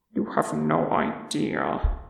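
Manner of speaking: low, soft mutter. A narrator's reading. Dwarf king grudgingly agreeing with an elf, muttering. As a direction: mutter it under the breath, pitched well down. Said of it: "Thorin muttered".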